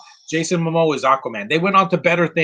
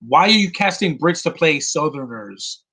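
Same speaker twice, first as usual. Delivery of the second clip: usual speaking voice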